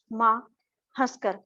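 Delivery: normal talking voice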